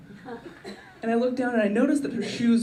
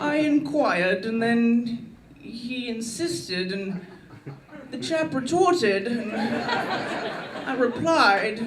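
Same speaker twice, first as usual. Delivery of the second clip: slow, drawn-out mock posh Englishman; hammed-up upper-class British, stretched words; posh British accent; 3 semitones higher, much slower than usual. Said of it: "I sound like I'm British".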